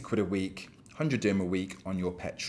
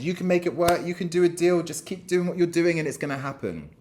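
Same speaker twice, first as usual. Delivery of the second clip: higher, hammed-up cheerleading voice; mock false encouragement; 7 semitones higher than usual. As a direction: play a smarmy boss stringing her along. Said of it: "strung her along and said".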